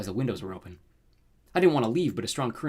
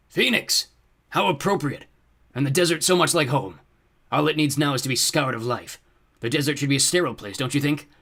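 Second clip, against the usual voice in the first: gloating villain, louder and higher; booming fiery lord; 4 semitones higher, 6 dB louder than usual. A narrator's reading. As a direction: boom it out with grand relish. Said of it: "a booming sound like thunder".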